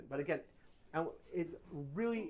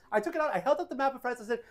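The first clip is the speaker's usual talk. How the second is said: usual speaking voice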